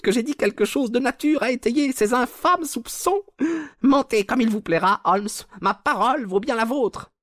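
loud falsetto villain protest; indignant Culverton Smith. A narrator's reading. Villain denying the accusation to the detective, indignant falsetto. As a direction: argue back in a very high head voice.

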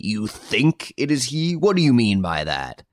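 slow, high incredulous challenge; impatient. A narrator's reading. Judge challenging the doubtful guard, impatient. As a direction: lean hard on "think", demanding. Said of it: "cried the judge impatiently".

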